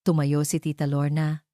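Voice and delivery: ordinary delivery, nothing marked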